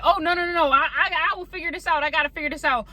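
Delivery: pitched high, rushing to refuse help; frantic refusal. Pretend. Diner turning down the owner's gift, high frantic insisting. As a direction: insist you'll fix it yourself, voice up high. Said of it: "rejecting that kind gesture".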